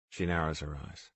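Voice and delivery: ordinary delivery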